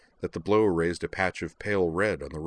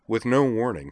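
ordinary delivery, nothing marked

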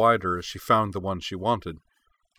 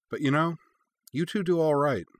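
higher, teasing reassurance; lilting, lightly teasing character voice; playful, with a wink; 8 semitones higher than usual. A narrator's reading. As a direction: say it with an audible wink. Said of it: "she said with a wink".

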